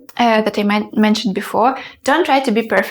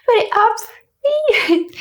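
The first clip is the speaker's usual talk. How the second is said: very high voice; 13 semitones higher, much slower than usual. Shown as a demonstration. Showing how her voice can climb, very high and stretched. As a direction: voice sliding way up, drawn out. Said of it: "my voice can go".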